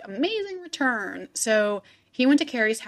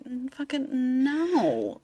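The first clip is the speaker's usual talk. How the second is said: slow, drawn-out no, imitating experts; slow retold dismissal; emphatic refusal; 3 semitones higher, 3 dB quieter, slower than usual. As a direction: stretch out a mock expert's flat refusal.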